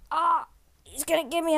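a hint of hammed-up dad in peril; mock distress. Dad pretending the monster grabs him, mock distress. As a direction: ham up being dragged away. Dad fakes being attacked.

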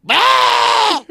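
very loud, high comic scream; piercing mock shriek; horrified scream. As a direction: scream in horror at a gory sight. Imitating wife seeing missing finger.

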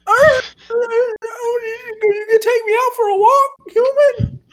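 high whiny excited puppy voice; puppy whimper. Retold or imitated. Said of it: "she's like".

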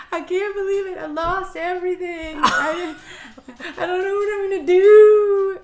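high-pitched teary wail, half laughing; retold crying. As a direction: replay the panic as a laughing sob. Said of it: "So I'm just crying".

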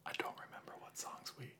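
sheepish whisper. Real, not acted. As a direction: whisper the confession, embarrassed. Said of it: "I like how you whisper".

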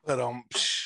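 imitated drum sting with a cymbal splash; mouthed rimshot. Host caps his own pulling-out joke, mock rimshot. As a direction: punctuate the joke with a vocal ba-dum-tss.

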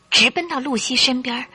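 usual speaking voice

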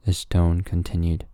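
usual speaking voice

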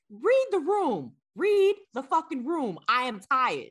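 high, loud mock scolding of guests; exasperated outburst. New mother venting at guests who overstay, exasperated.

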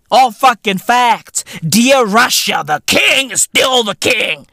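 usual speaking voice

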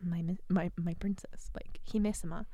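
mock-sultry whispered devotion; mock dub Hawk. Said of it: "And Hawk in the dub is like".

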